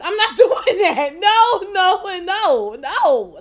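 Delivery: high, loud laughing no's; laughing loud refusal. Real, not acted. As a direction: shout the no's through a laugh. Laughing refusal after story punchline.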